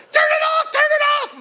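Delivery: high, loud pleading shout; frantic high cry to turn the machine off; panicked yell. Retold or imitated. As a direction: scream for them to stop it. Yelling while being shocked.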